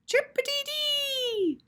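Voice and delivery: very high, slow chirp; high sing-song chirp; chirping cockatiel. Acted. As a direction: chirp it like a bird.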